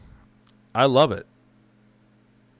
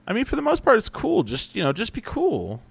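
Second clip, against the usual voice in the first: high plea. Real, not acted. Pleading with fans.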